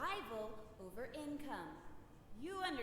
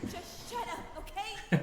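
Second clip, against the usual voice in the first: angry shout; 8 semitones higher, 7 dB louder than usual. Acted. Frustrated spirit yelling.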